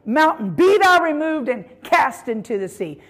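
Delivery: firm, full-voiced command; speaking with authority. Shown as a demonstration. Preacher ordering a mountain into the sea, authoritative. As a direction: declare it as a binding order. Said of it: "we've got to speak with authority".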